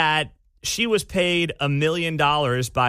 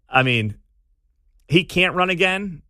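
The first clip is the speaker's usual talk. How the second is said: ordinary delivery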